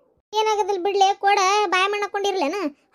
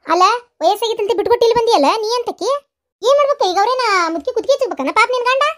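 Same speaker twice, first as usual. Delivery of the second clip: scolding; 5 semitones higher, 5 dB louder than usual. Acted. Quarrel about caring for old mother.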